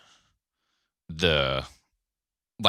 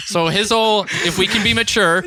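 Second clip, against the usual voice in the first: laughing; 9 semitones higher, 4 dB louder than usual. Real, not acted. Begging them to be mature.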